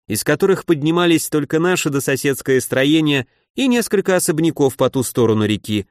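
plain reading voice

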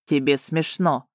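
slow, low cold statement; icy tone. A narrator's reading. Dragon watches her laugh, icy. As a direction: state it coldly, no question. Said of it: "ледяным тоном констатировал дракон".

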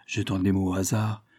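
ordinary delivery, nothing marked

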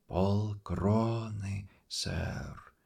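put-on slow faint old man whisper. A narrator's reading. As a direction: breathe it like dry dust. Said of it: "прошептал старик".

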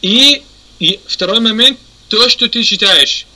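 ordinary talk

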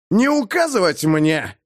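high, indignant snap; prickly, defiant outburst; snapped retort. A narrator's reading. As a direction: snarl back at being ordered around. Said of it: "огрызнулся Бэкфилд".

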